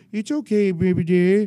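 high, loud, cutesy pet-talk; exaggerated high-pitched baby talk; imitated dog-soothing voice. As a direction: coo loudly to a dog, hammed up. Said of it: "I'm doing his voice".

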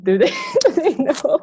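high, loud question broken by laughter; real giggling. Real, not acted. Co-host asking host whether listeners know, real giggling. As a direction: laugh your way through the question. Giggles after the language demonstration.

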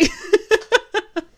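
high, loud burst of laughter; big amused laugh. Real, not acted. Woman laughing at her bad day story, amused laugh. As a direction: crack up at your own story.

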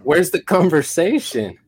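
high, drawn-out questioning; incredulous protest. Retold or imitated. Fired channel member asks for the promised talk, incredulous.

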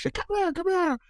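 high excited calling voice; mother calling. Retold or imitated. Mother calling Sherwin from the kitchen, high and eager.

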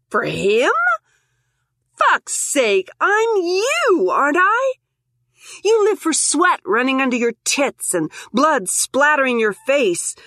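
high, mocking doppelganger taunt. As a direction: goad her like a feral twin.

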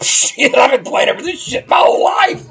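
loud, high excited yell; excited shout. Retold or imitated. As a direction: shout it out, thrilled. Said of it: "I'm like".

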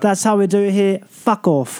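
put-on rough North London geezer; cocky North London brush-off, imitated; North London accent. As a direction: do a North London tough talker. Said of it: "I'm from North London".

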